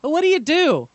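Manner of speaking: a nosy barista asking; chatty barista. Retold or imitated. Barista at the coffee shop asks what he does, in a high, loud chatty voice. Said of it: "they're like".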